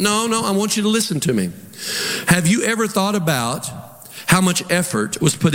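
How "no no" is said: ordinary delivery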